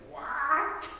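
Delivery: very high, stretched WHAT; baffled disbelief. Retold or imitated. People reacting to a wife leaving, baffled disbelief. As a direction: squeal it in exaggerated disbelief. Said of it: "throw up their hands and say".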